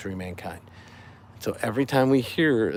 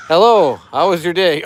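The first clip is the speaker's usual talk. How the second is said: higher, louder chirpy dad greeting; dad joke voice; 5 semitones higher, 9 dB louder than usual. Acted. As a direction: read it like a cheerful dad. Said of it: "I'm doing it in dad joke voice".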